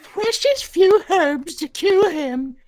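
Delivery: put-on high, slow old-cat voice; elderly voice. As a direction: voice her as an old she-cat, slowly. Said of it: "I should make her sound elderly".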